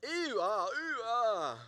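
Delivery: loud, high swings between "ew" and "ah"; playfully waver from disgust to delight; mixed reactions. Pretend. Pastor echoing a divided congregation, loud see-sawing ew and ah.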